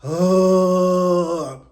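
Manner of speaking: loud fed-up ugh; disgusted groan. Retold or imitated. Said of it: "she's just like".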